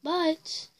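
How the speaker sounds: usual speaking voice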